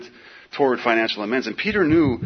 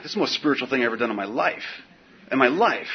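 normal talking voice

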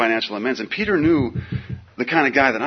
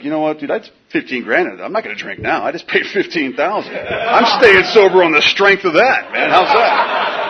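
louder, slower laughing boast; mock boast; 6 semitones higher, 8 dB louder than usual. Telling Peter he'll stay sober for fifteen grand, laughing. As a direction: brag it, drawn out and laughing. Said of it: "I was like".

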